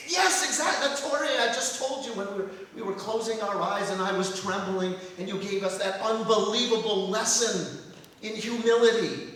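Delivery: high, loud, impassioned outpouring; fervent excitement. Acted. Grateful pupil prompting his rabbi's memory, fervent excitement.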